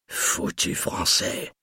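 muttered under the breath, slow and toneless. A narrator's reading. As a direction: grumble it softly and slowly to yourself. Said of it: "marmonna-t-il".

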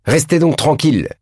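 teacher scolding the noisy class, indignant. A narrator's reading. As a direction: scold the whole room. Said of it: "continuait le professeur indigné".

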